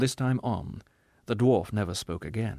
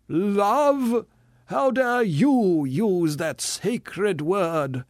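loud, high, slow indignation; outraged tragedian; 9 semitones higher, 6 dB louder, much slower than usual. Acted. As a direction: fling it back as sacrilege.

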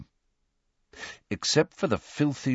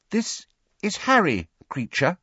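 tentative; 11 semitones higher than usual. A narrator's reading. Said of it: "said Hermione tentatively".

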